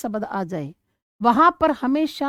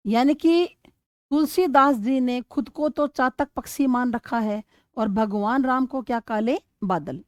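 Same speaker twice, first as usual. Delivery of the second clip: ordinary delivery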